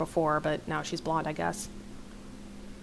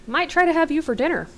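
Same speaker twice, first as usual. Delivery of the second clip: high, loud teasing quip; joking; 9 semitones higher, 5 dB louder than usual. A narrator's reading. Riley teasing a friend about chicken legs, joking. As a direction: tease a friend playfully in a higher voice. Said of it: "Riley jokes".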